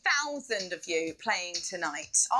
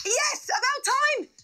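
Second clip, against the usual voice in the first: high, louder whoop of relief; excited cheer; 13 semitones higher, 5 dB louder than usual. Acted. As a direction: cheer it like the moment finally came. Host cheers the prize question.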